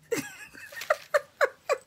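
high squealing laugh; delighted laugh. Real, not acted. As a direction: burst into a shrill-free giggle. Laughing at own hype.

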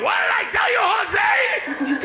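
high, loud scolding mom shout; shrieked parental telling-off, acted; angry mother. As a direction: imitate a mother yelling at her son. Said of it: "She turned around".

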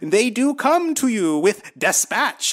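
high, slow, solemn biblical preaching; lofty old-timey Jesus voice, drawn out. Retold or imitated. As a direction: intone like a pompous prophet. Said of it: "That's his Jesus".

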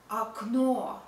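окно as "ak-NO", final o full; stress on last o. Shown as a demonstration. Teacher explaining stress, окно as "akNO". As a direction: lean on the end of окно. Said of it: "o is stressed".

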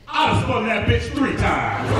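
put-on old barfly's drawn-out boast; boasting old man. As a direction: draw it out like a cocky old-timer.

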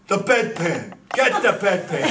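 loud, laughing bark for the bedpan; punchline demand. Retold or imitated. Nun demanding bedpan from priest, loud laughing. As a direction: belt out the punchline through laughter.

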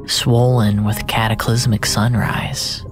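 ordinary delivery

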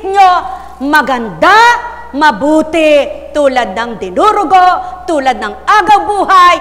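loud, punched-out phrases with pauses; emphatic shout from the pulpit; shouted preaching. Real, not acted. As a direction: preach it at full volume, phrase by phrase. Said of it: "ba't, [name], sumisigaw ka pa".

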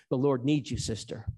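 normal talking voice

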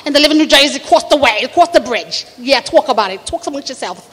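rounded New Jersey "tawk" vowels; Dolores. Acted. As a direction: brag about the doctor son in thick New Jersey. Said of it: "who talks like this".

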